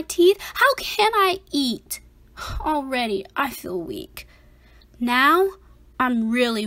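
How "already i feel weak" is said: much lower and softer, fading; feeling weak. Acted. Scared boy telling the reader he feels weak. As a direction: let the voice sink away as if strength is going. Boy fears he is falling apart.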